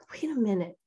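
breathy private whisper, caught off guard; whispered to herself. Retold or imitated. Retold thought to herself after her grandson's hurt, whispered. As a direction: whisper it as a sudden thought to yourself.

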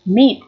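"Meat" with its long ee slightly drawn out; lengthened vowel. Shown as a demonstration. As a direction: stretch the ee in "Meat" a little. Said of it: "kéo dài cái âm Y này ra một chút".

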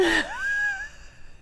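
high-pitched peal of laughter; delighted laugh. Real, not acted. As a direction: laugh hard at your own mock outrage. Laughs after mock challenge.

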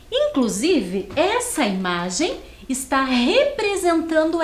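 put-on slow, lively storybook read for kids; reading to children. Teacher reads the Bob text slowly to young pupils. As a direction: read it out slowly and brightly to the class.

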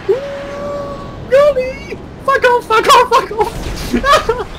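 very high loud squeals; squealing. Real, not acted. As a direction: squeal in outrage. Attacked near goal.

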